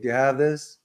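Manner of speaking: ordinary delivery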